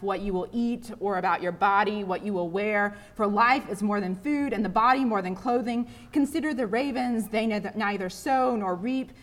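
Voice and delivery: loud, rhythmic gospel reading; scripture reading. Acted. Preacher reads Jesus's words to the congregation, proclaiming.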